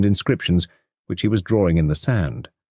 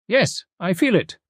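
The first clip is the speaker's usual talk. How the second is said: high, precise detective voice; Poirot's voice; 12 semitones higher than usual. A narrator's reading. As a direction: speak as the fussy little foreign detective. Poirot's voice in story.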